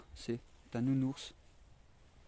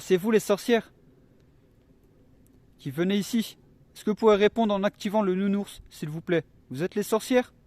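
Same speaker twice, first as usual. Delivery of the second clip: calling out loud; 6 semitones higher, 11 dB louder than usual. Real, not acted. Calling out to spirits.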